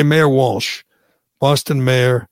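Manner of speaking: ordinary talk, nothing marked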